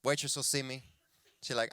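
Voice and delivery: usual speaking voice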